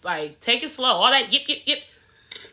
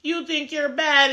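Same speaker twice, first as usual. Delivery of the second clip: higher put-on voice of a ranting rival; hammed-up Anna lecturing Scotty; mocking Anna's rant; 7 semitones higher than usual. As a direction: sneer it out like the cast member you're mocking. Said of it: "Anna starts going on this spiel, this rant".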